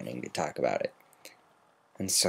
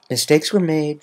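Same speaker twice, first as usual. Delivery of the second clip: admitting; 8 semitones higher, 7 dB louder than usual. A narrator's reading. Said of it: "she admitted".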